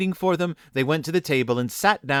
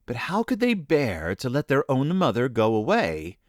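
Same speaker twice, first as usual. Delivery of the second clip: usual speaking voice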